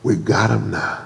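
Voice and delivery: soft low gloat; lightly voiced gloating Pharaoh. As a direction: savor the trap quietly. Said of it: "Pharaoh said".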